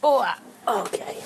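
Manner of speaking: ordinary delivery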